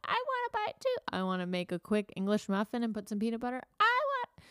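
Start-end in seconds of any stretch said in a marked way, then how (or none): 0.0-1.0 put-on high whiny little kid; whiny child voice. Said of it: "child wants share". 3.8-4.5 put-on high whiny kid voice; whiny child voice. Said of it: "child wants share".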